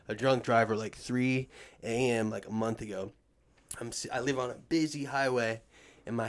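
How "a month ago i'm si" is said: ordinary talk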